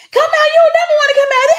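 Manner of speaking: high, loud, far mock nagging; pushy friends nagging. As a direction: mimic friends pestering her to go out. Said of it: "I was so used to people saying".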